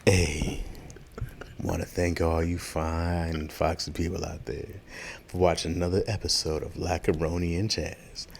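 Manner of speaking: late-night R&B host voice; sexy sign-off. Acted. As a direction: purr the thanks softly, taking your time. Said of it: "we still doing sexy".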